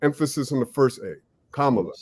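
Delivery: "Kamala" dengan tekanan pada A pertama, KA-mala; stress on first A. Shown as a demonstration. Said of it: "Sometimes I gotta talk to myself".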